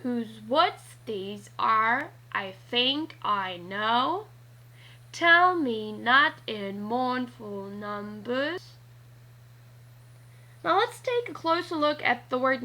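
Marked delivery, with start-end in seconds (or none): 0.0-4.3 slow, even da-DUM beat on "Whose woods these are, I think I know"; iambic reading. Shown as a demonstration. Teacher reading slowly to show the iamb beat. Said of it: "Listen to how I read". 5.1-8.7 slow, DUM-da beat on "Tell me not in mournful numbers"; trochaic reading. Shown as a demonstration. Teacher reading slowly to show the trochee beat. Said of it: "Listen to how I read".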